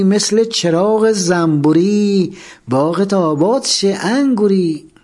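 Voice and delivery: sing-song street vendor crying his grapes, high and drawn out; fruit seller's call. Retold or imitated. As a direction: call out melons and grapes like a market hawker.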